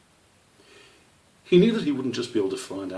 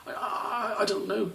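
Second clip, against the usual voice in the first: put-on young squirrel stammering to his stern father. A narrator's reading. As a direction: stumble over the first word in fright. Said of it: "Squish stammered".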